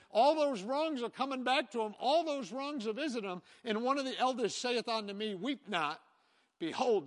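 loud, high, rousing preacher's voice; full preaching. Acted. Pastor preaching John's vision to the congregation, loud and rousing. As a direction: ring out the scripture like a proclamation.

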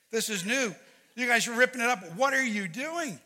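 high, building to loud disbelief; acted-out alarm, slowing on the last question; incredulous protest. Pastor acting protest to daughter.

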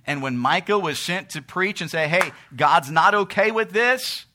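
loud, high preaching cry; full-force preaching. Acted. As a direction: preach it out at the top of the voice.

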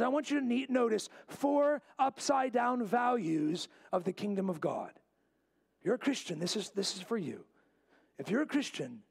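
ordinary talk, nothing marked

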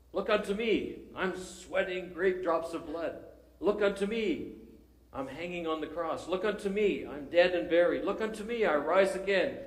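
high, loud pulpit refrain building to a cry; hammed-up village preacher pleading; fervent preacher. Lay preacher pleading with the congregation, high and rising.

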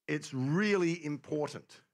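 slow, with "really" drawn out and leaned on; stress on really. Real, not acted. Preacher on the psalmist wanting attention, stress on "really". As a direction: hit "really" hard and stretch it.

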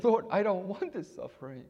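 high, loud pleading prayer; pleading cry to God. Retold or imitated. Preacher retelling his night prayer, crying out high and loud. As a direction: beg the Lord to lift the suffering. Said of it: "I said".

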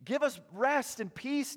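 high, hopeful acclaiming cry; crowd shout. Acted. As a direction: hail him as king. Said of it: "they're shouting out".

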